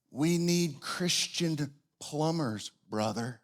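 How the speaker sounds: slow, soft Southern Tennessee drawl imitating a friend; Southern Tennessee accent. Bible college RA Tucker preaching to a late-night debater, slow Southern Tennessee accent. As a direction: lay on a rich Southern Tennessee accent, unhurried. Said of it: "in his rich Southern Tennessee accent".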